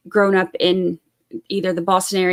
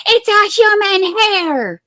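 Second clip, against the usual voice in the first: high, slow, drawn-out mock insistence; mocking Wendy Murphy; 12 semitones higher, 5 dB louder, much slower than usual. Streamer mocks a legal commentator with high, slow insistence. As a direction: draw it out high like a smug know-it-all. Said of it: "Wendy Murphy was like".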